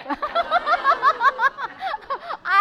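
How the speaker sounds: long, loud, high laugh that falls away; a big real laugh. Real, not acted. Doctor laughing at surviving death threats, big laugh.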